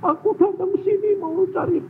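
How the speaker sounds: high-pitched, tearful complaining voice; wailing woman. Retold or imitated. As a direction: whine that you came for comfort. (keluhan perempuan sambil menangis)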